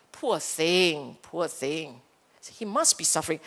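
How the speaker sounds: low, soft, pitying tone; sympathetic self-talk. Acted. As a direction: murmur it gently with compassion. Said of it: "So you're talking differently".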